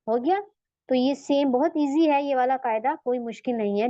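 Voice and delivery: ordinary talk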